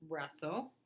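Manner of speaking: ordinary delivery, nothing marked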